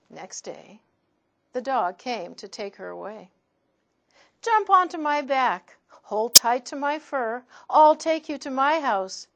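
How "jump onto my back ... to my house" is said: higher, louder friendly dog; lightly voiced cheerful dog. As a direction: invite her aboard warmly. Dog speaks to girl.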